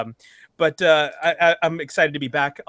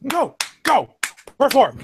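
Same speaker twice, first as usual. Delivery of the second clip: high, loud playful orders; mock commanding; 10 semitones higher, 9 dB louder, slower than usual. Host joining the perform-monkey gag, mock command. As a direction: shout it as a hammed-up taskmaster.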